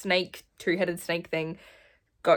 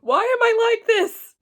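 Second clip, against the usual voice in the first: emotional squeal; 9 dB louder than usual. Real, not acted. Tearing up while reading.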